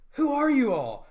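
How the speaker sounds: put-on high, loud demanding girl; girl's shouted question. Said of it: "they go".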